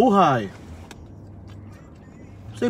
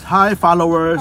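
cheerful greeting; 4 semitones higher, 5 dB louder than usual. Shown as a demonstration. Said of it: "Say hi to your followers".

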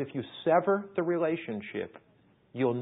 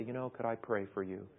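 gentle asking; 3 semitones lower, 8 dB quieter than usual. Acted. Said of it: "gently and tenderly".

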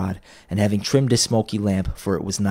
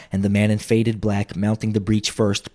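ordinary delivery

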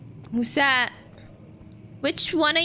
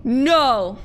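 loud cry; 8 dB louder than usual. Real, not acted. Grandma's spirit escapes.

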